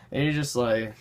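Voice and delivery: ordinary talk, nothing marked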